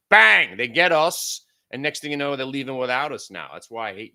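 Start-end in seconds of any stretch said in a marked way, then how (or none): 0.1-1.5 loud exclamation. Real, not acted. Dramatic exclamation after mock quote.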